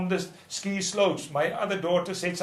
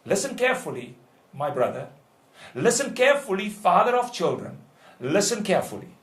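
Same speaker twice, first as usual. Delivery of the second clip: ordinary delivery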